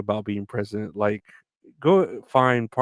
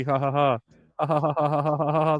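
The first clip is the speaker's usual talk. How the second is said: fake ha ha laugh; 5 semitones higher, faster than usual.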